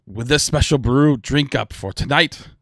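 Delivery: high, loud, rousing pirate toast; triumphant captain's battle cry; pirate lord's toast. Acted. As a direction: proclaim it to the whole crew, building to the last words. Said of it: "he's like".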